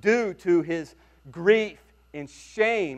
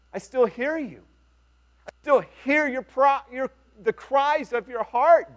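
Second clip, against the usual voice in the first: tender reassurance; 7 semitones higher than usual. Retold or imitated. Voicing God's reply to David.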